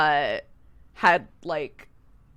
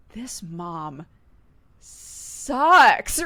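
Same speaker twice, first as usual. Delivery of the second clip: this mom sucks; 4 dB louder, much slower than usual. Retold or imitated. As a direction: build to a disgusted outburst on sucks. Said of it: "where I have been like".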